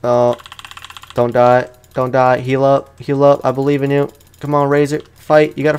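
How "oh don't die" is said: usual speaking voice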